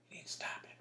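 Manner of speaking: mom's stern whisper, imitated. Mom whispering at her goofing son in the store. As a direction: hiss it low so nobody in the store hears.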